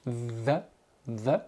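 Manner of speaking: THE as a short, low, soft voiced th; isolated th sound. Shown as a demonstration. Teacher showing tongue placement, isolated voiced TH. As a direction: say "the" softly, tongue at the front teeth. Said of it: "placer la langue sous vos deux dents".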